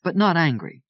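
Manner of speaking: plain reading voice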